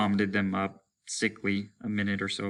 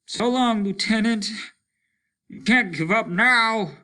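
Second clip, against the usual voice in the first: loud farewell; 12 semitones higher, 6 dB louder than usual. Acted. Loud farewell to deafened man.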